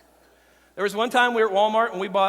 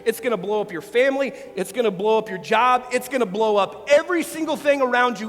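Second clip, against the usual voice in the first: climbing louder and higher, each refrain harder; rising altar call; 3 semitones higher, 3 dB louder than usual. Acted. Pastor building an altar call to his congregation, rising. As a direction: build the appeal to a peak.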